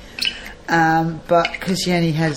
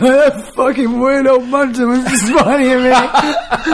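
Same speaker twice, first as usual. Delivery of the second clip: imagined disgusted man; 7 semitones higher, 7 dB louder, slower than usual. Retold or imitated. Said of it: "You'll be like".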